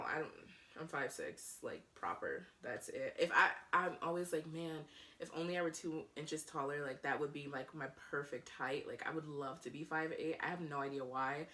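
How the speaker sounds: normal talking voice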